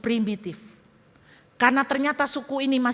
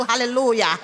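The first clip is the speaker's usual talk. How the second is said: ordinary delivery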